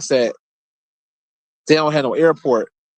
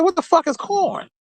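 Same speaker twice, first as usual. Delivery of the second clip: high, squeaky disbelief, pitched way up; incredulous. Retold or imitated. Retelling his grandpa's news, shocked and high. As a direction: act floored by the word corn. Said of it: "I said".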